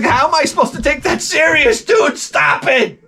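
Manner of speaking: high-pitched incredulous plea; exasperated protest. Retold or imitated. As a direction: squeal it in comic exasperation. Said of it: "I'm just like".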